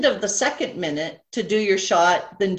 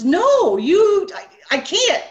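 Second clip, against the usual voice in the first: high, loud mock hysterics; high-pitched retold protest, louder, breaking off; imitating helpless laughter; 12 semitones higher, 7 dB louder, slower than usual. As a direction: act out being too tickled to explain. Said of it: "He's laughing".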